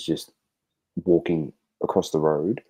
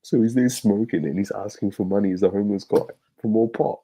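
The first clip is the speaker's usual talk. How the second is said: ordinary talk, nothing marked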